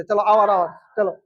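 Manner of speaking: ordinary talk, nothing marked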